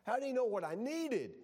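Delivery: high, wondering voice of an amazed listener; awed gasp of wonder. Retold or imitated. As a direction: marvel at it like a delighted churchgoer. Congregant marvels at the sermon.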